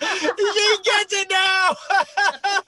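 high, drawn-out squeal through laughter; laughing shout. Real, not acted. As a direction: shout it slowly while cracking up. Co-host cracks up at her.

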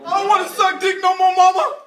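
high, loud protest; high shouted complaint to mama; whining outburst. Acted. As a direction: yell it like a fed-up child.

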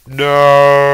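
loud high cry; despairing shout. Real, not acted. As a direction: yell out in despair. Dies in game.

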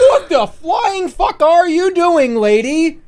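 gasp, then high, loud, slow outrage; shocked outburst. Real, not acted. Streamer outraged at the lady, loud shocked yell. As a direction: drag out the shocked curse.

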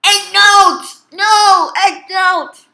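loud, high-pitched repeated shout; frustrated yelling. Real, not acted. Player failing at the voice-controlled game, yelling.

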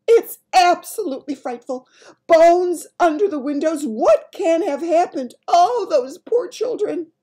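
high, loud royal lament; horrified cry. Acted. Queen over the bones under windows, horrified. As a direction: exclaim in mounting horror. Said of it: "the famous voice cried out".

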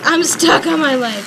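real frustrated outburst; frustrated exclaim. Real, not acted. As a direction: stress stuck in exasperation. Repeats complaint, second louder.

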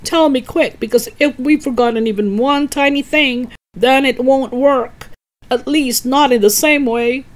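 put-on old man cheering on a boy cooking a potion, excited; excited eager old man. A narrator's reading. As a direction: egg the boy on, giddy with impatience. Cranky anxious about the recipe.

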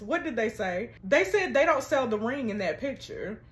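normal talking voice

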